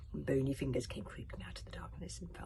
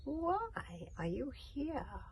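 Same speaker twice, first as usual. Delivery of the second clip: very slow, higher witch question; 9 semitones higher, much slower than usual. A narrator's reading. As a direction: drag it out, sly and eerie. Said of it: "asked the witch".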